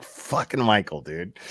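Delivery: usual speaking voice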